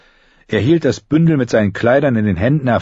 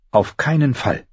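ordinary delivery

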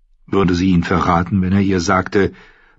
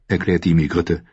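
normal talking voice

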